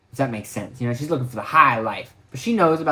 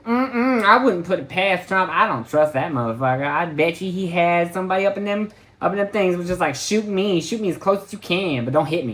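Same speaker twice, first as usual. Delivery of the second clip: sassy lady impression; 6 semitones higher than usual. Uber driver telling him her Trump theory, high and sassy. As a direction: play the sassy driver, pitch up and sure of herself. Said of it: "She was like".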